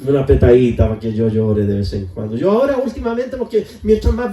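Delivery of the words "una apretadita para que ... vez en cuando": low pleading prayer. Pretend. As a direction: ask God humbly, voice dropped low. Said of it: "pídale al Señor". (súplica en voz grave y suave)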